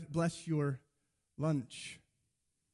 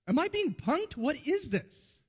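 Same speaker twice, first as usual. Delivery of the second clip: very high, disbelieving mock protest; incredulous outburst; 10 semitones higher than usual. You react to your friend's silly wish, acted incredulity.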